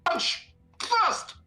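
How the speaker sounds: whispered high insistence; high whispered demand. Acted. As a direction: hiss it eagerly. Character demands.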